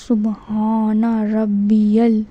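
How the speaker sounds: slow سبحان ربی ال, ending "rabbi-yal"; correct recitation. Shown as a demonstration. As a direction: stretch سبحان ربی ال syllable by syllable. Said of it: "تو ہم کو کیا پڑھنا ہے".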